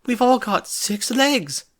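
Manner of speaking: high, loud and slow, eyes bulging; alarmed reveal. A narrator's reading. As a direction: drop the shocking news. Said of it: "eyes bulging".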